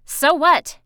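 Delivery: loud, high, dismissive jab; scornful retort. Acted. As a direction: throw it back loudly, unimpressed. Pip snorts, scorning the lecture.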